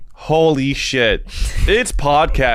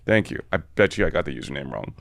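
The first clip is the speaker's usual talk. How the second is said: ordinary talk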